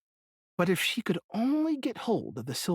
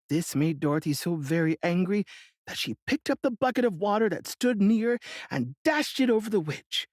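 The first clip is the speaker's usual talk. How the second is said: ordinary delivery, nothing marked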